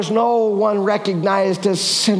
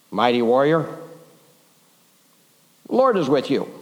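angel; 6 semitones lower than usual. Acted.

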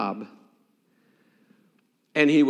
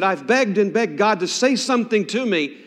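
loud, raised-pitch desperate demand; frustrated plea, high and forceful; angry pleading; 6 semitones higher, 5 dB louder than usual. Retold or imitated. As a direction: cry out angrily to the pastor for help. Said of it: "He said, very angrily".

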